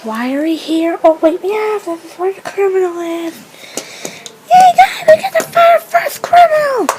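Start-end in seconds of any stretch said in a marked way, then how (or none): 4.5-6.9 high, loud gleeful cheering; excited cheer. Pretend. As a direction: cheer like you just won. Cheering over catching the criminal.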